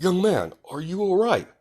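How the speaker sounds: high, projected hero voice; concerned hero. Acted. All Might checking on the rescued boy, high hearty hero voice.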